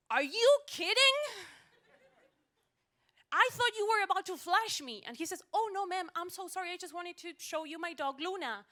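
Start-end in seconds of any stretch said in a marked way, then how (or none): 0.1-5.0 loud, high indignant outburst; outraged. Acted. Passenger confronting the taxi driver after a scare, outraged. 5.5-8.7 high, flustered apology; apologetic driver. Acted. Taxi driver apologizing to a frightened passenger, flustered.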